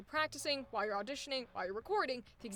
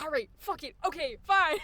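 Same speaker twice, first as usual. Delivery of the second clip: exasperated giving in; 4 semitones higher, 9 dB louder than usual. Retold or imitated. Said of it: "you were like".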